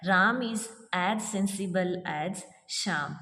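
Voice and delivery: each word read out slowly; slow dictation. Shown as a demonstration. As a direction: dictate slowly for students to copy. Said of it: "तो देखिए हमें पता लग रहा है as". (धीरे-धीरे बोलकर लिखवाना)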